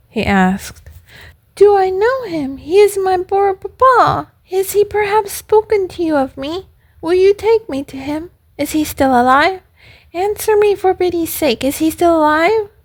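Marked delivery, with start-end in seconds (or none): 1.5-12.7 high, urgent little puppet voice; acted eager, worried questioning; anxious puppet. A narrator's reading. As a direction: plead for news in a high-pitched puppet voice. Puppet pleads for news of papa.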